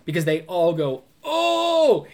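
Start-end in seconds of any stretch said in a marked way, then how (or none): 1.2-2.1 high, loud drawn-out ooh of onlookers; long taunting playground "oh!"; mock crowd "oh". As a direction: imitate siblings hooting at someone in trouble. Imitating onlookers reacting to trouble.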